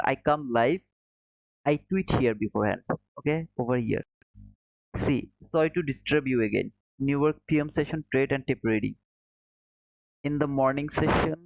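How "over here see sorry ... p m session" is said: ordinary talk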